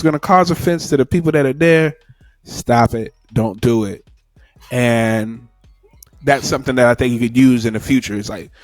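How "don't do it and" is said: usual speaking voice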